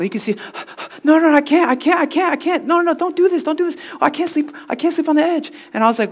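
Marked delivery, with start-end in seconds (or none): 0.4-1.0 imitated panicky gasping; panicked gasp. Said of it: "He's panicking". 1.0-5.5 high, hammed-up panicky pleading; panicking. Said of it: "He's panicking".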